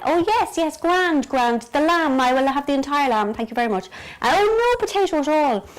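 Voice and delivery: high, loud show of delight; pretend enthusiasm. Pretending to accept the entire lamb, hammed-up enthusiasm. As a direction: gush loudly as if you mean it. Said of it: "I have to pretend that I".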